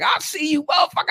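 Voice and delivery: high, playful call across traffic; mock the lady calling him out from her window; high-pitched shouted tease; teasing call-out. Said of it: "And she's like".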